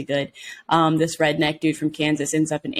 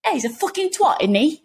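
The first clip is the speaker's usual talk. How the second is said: high-pitched, hammed-up Cockney roughneck accent; mock Cockney accent; 10 semitones higher, 3 dB louder than usual. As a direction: toss it off in an overdone Cockney accent. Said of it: "Cockney roughneck accent".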